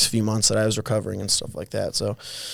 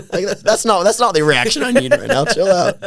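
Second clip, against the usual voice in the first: laughing exasperation; 10 semitones higher, 7 dB louder than usual. Real, not acted. Complaining about teammates' reaction.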